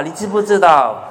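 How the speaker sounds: usual speaking voice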